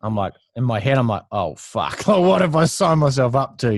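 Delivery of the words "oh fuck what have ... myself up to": high, loud laughing dismay; mock panic. First day, apprentice to himself, high laughing dismay. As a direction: laugh it out like a kid who's just realised his mistake.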